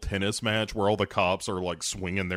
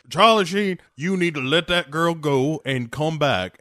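mock Southern lawyer; 5 semitones higher, 5 dB louder than usual. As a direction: play the pleading Southern lawyer on the phone. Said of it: "He's like".

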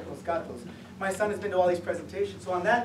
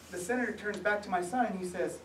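usual speaking voice

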